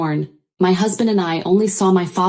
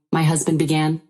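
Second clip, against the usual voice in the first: ordinary delivery, nothing marked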